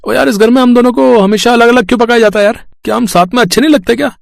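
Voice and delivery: squeaky-high complaining vegetable, hurt and whiny; cartoon pea moaning why they're always cooked apart; sad talking vegetable. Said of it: "आलू मटर बहुत उदास थे".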